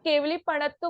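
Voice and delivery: पणत्तो with one plain ण; पणत्तो, the common slip with single ण; half ण dropped. Shown as a demonstration. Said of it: "जो आधा ण है उसका उच्चारण नहीं हो पाता".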